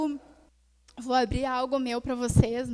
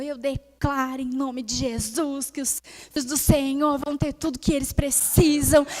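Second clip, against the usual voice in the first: building to a loud proclamation; retold fervent prayer, swelling in volume; imitated prayer declaration; 7 dB louder than usual. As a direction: declare it like a mother praying with authority. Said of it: "Ela começou".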